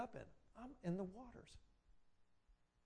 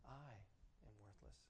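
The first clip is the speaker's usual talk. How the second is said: soft, low, resigned; self-condemnation; 6 semitones lower, 17 dB quieter than usual. Retold or imitated. The enemy's words taken as our own, quiet and low. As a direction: let it sink out almost unheard. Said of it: "They say".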